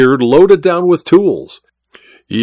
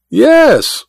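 much higher, mock echo of his disbelief; mimicking Goodall; 12 semitones higher than usual. Welton mockingly echoing Goodall's tone back at him. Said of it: "mimicking Goodall's tone".

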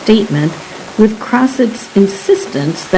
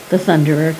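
plain reading voice

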